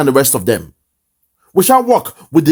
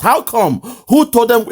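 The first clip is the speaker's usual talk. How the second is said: mocking question; 6 semitones higher than usual. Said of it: "he's still asking".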